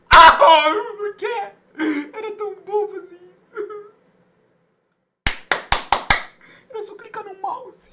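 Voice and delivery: high, slow mock sobbing; mock crying. Streamer to chat over the game, hammed-up crying. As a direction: blubber theatrically like a crying child.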